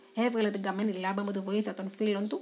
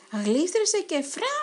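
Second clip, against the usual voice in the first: higher, louder, drawn-out slipping sound; whoosh of the fall; 7 semitones higher, 5 dB louder, slower than usual. A narrator's reading. Narrator acting out the mouse slipping down the stairs. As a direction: let the fall whoosh out slowly.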